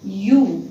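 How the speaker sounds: यू stretched slowly as a long yoo; यू as a slow, clear yoo; drawn-out yoo sound. Shown as a demonstration. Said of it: "इसका उच्चारण हो जाता".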